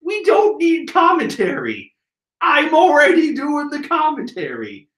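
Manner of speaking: high-pitched, ranting frustration; exasperated anger. Real, not acted. Manga reviewer ranting at nameless minions, high exasperation. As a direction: vent comically, voice climbing high. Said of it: "Moving on from my anger".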